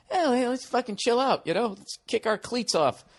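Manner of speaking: easygoing mock player; mock lazy player. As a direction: imitate a team getting cocky. Mocking a complacent team.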